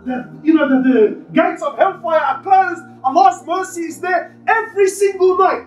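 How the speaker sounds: high, urgent sermon voice with rising passion; impassioned preaching. Acted. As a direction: preach it with full force to the congregation. Preacher building emotional Ramadan appeal.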